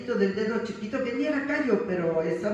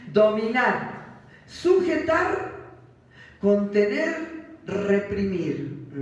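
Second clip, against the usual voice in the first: each word drawn out slowly and louder; slow emphatic definitions; 4 dB louder, much slower than usual. Shown as a demonstration. Preacher reading definitions slowly and deliberately. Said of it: "Veamos algunas definiciones".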